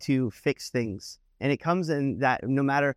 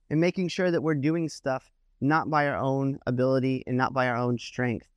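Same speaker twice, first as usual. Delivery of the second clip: ordinary talk, nothing marked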